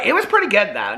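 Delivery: ordinary delivery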